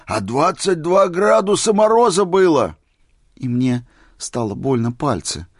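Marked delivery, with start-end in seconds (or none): 0.0-2.8 high, loud marvelling exclamation; ecstatic shout. A narrator's reading. Old man boasting of the frost Misha endured, ecstatic. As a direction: cry it out in amazed rapture. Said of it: "восторженно закричал старик".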